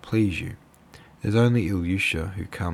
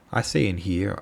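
ordinary delivery, nothing marked

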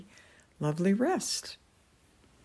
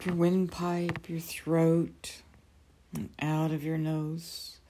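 guided meditation. Acted. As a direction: ease them gently into calm.

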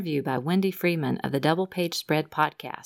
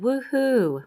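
higher-pitched mild "woohoo" cheer; quoted happy cheer; 8 semitones higher, 3 dB louder than usual. Retold or imitated. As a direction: read out a winner's whoop, pitched up. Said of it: "when he heard he had won a prize".

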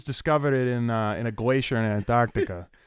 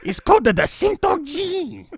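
high, loud mock-German scientist; horrible German accent; 5 dB louder than usual. German scientist announcing his gene discovery, excited bad German accent. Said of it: "That's a horrible German accent".

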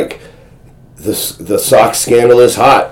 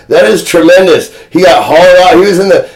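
ordinary talk

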